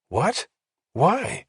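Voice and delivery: sharp rising high disbelief; surprised. A narrator's reading. Woman hearing he spent the night in jail, surprised. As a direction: jump in startled.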